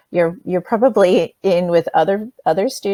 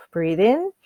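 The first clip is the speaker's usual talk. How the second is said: guided breathing cue. Shown as a demonstration. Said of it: "you're gonna breathe in".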